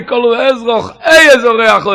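loud shout on a high pitch; crying out. Retold or imitated. Preacher acts out the cry of the soul, shouting. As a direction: cry out with full force. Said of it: "der Schnellschrei".

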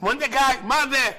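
high, exasperated call; coach yelling. Retold or imitated. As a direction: demand what that was. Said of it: "צועק לו המאמן".

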